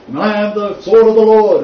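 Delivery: loud, high, hammed-up pomposity; mock grandiose proclamation. Teacher imitating a man with many titles, mock grandiose. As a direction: proclaim it like a self-important reverend. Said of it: "he says".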